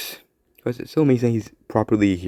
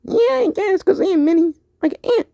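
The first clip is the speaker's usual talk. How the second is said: put-on much higher squeaky kid voice; Mini Spider-Man. Mini Spider-Man announcing himself to a friend, squeaky high. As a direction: squeak it out proudly. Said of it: "you talk very weird".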